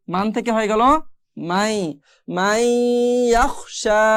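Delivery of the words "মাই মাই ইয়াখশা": "মাই" with the n turned to a nasal glide; merged ghunna. Shown as a demonstration. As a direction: stretch "মাই" nasally, then "ইয়াখশা".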